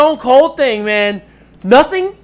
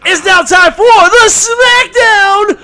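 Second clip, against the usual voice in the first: announcer shout; 9 semitones higher, 5 dB louder, slower than usual. Acted. Announcing the show segment.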